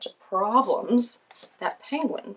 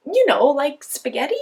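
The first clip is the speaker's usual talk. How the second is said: put-on kid voice; 7 semitones higher, 11 dB louder than usual. Kid asks the lion.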